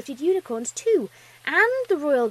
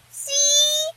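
high, loud, long squeal; defensive little filly; 8 dB louder than usual. A narrator's reading. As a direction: squeal it like a vindicated small child. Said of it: "Twilight defended".

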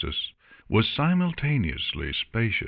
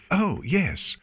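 stiff; 11 semitones higher than usual. A narrator's reading. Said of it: "suddenly stiff".

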